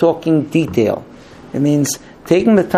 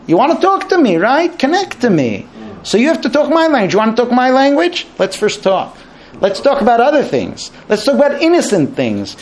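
higher, animated wife explaining herself; voicing the wife; 8 semitones higher than usual. Wife telling her husband to talk her language, animated. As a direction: speak for the wife, coaxing.

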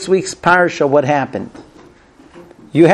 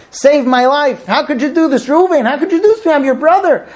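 much higher, desperate plea, lightly acted; a boy begging his brothers for his life; begging child; 9 semitones higher than usual. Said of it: "the child begging his brothers".

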